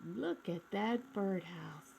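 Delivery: ordinary delivery, nothing marked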